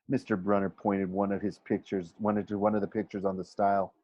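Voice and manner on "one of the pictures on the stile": usual speaking voice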